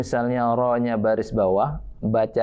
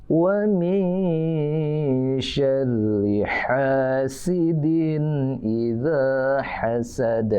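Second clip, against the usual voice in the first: Quran recitation; much slower than usual. Shown as a demonstration. Teacher chanting a verse for children to repeat, slow and drawn out. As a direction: chant each word slowly for the class to copy.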